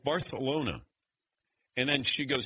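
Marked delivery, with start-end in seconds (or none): 0.0-0.8 Barcelona with a th in place of s; lisped pronunciation. Retold or imitated. Sister mimicking her traveler brother, Barcelona with a th. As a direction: mimic Barcelona as Bar-thuh-lona. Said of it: "you're like Barcelona".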